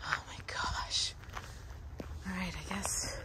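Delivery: hushed, voiceless aside; whispering. Real, not acted. Vlogger near the film house among visitors, whispering. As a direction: whisper it softly to yourself.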